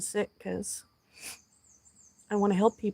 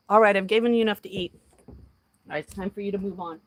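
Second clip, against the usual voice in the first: ordinary delivery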